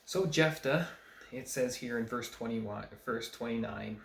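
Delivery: usual speaking voice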